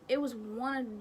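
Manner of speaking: ordinary talk, nothing marked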